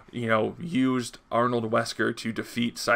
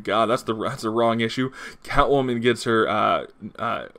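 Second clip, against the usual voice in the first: normal talking voice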